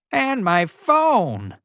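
high, drawn-out slow wail. A narrator's reading. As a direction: stretch the loss out, wailing. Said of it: "Morton wailed".

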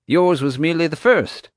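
breezy park owner brushing it off; lightly voiced park owner; mild showman voice. JJ character voice.

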